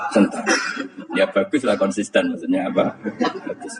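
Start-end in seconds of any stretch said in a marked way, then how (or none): none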